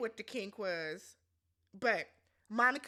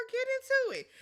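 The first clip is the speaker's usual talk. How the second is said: high cheering quote; 11 semitones higher, 6 dB louder than usual. Retold or imitated. Retold cheering line.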